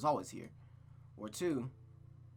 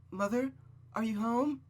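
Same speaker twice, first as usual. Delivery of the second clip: put-on high, raised girl's voice calling; calling into the house; 9 semitones higher, 4 dB louder than usual. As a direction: call out into an empty house. Rose calls into silent house.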